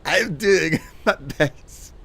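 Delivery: laughing. Real, not acted. Laughing at failing beeps.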